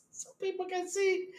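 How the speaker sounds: high-pitched, laughing squeal; cracking up while talking; helpless laughter. Real, not acted. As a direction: barely get the punchline out through real laughter. Cracking up at own joke.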